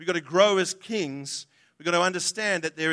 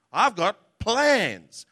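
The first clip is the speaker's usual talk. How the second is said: "plans" drawn out slow and leaned on; stress on plans; much slower than usual. Shown as a demonstration. Preacher stressing God's word to the congregation, slow weight on plans. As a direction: linger on "plans" and land it heavy. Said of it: "the emphasis is on the word plan".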